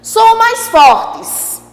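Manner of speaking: "são mais fortes" devagar e agudo; ênfase em "fortes". Shown as a demonstration. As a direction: frisa a força das vogais. (explica devagar e com ênfase)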